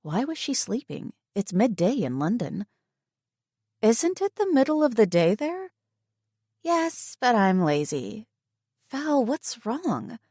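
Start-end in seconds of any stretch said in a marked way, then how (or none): 3.8-8.3 put-on higher teasing girlfriend voice; playful best friend; friend's voice. Voicing friend Tegan. 8.9-10.2 high, slow worried friend; concerned friend. Acted. Voicing friend Tegan.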